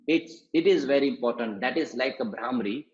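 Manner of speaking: normal talking voice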